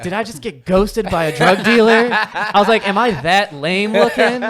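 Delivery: loud, high-pitched comic disbelief; hammed-up wounded incredulity; incredulous outrage. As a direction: replay your indignant disbelief, loud and high. Retelling outrage at being ghosted.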